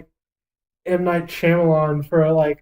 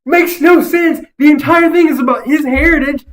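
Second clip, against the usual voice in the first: outraged; 10 semitones higher, 10 dB louder than usual. Real, not acted. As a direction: bellow how pointless it is. Outraged at movie plot.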